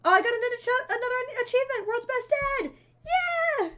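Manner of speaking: real joy over the achievement; thrilled shout. Real, not acted. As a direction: yell it out like you just won. Unlocking an achievement.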